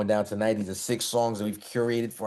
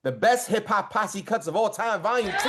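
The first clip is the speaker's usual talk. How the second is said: announcer voice; 11 semitones higher than usual. Acted. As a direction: draw out each word like an arena announcer. Announcing episode title like emcee.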